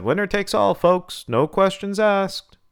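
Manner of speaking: high, loud showman's call; promoter's announcement. A narrator's reading. Promoter addresses the crowd.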